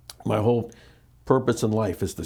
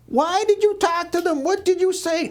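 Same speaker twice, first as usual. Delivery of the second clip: high, shrill imitation of a panicked mother; mock the mother's frantic screech, much higher; mother screaming. Son imitating his mother on the phone, high frantic screaming.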